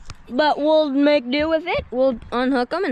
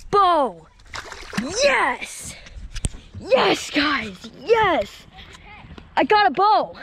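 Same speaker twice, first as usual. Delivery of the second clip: celebrating; 3 semitones higher, much slower than usual. Real, not acted. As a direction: cheer like you just won. Celebrating the catch.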